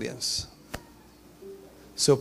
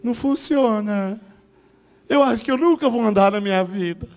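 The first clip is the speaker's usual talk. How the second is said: put-on whiny child; 6 semitones higher, 4 dB louder than usual. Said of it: "você deve ter pensado assim".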